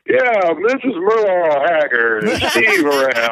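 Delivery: slow mock caller, stretched words swooping up high; prank caller voice. Older brother prank-calling his little brother, drawled put-on voice. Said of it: "he would always mess with me".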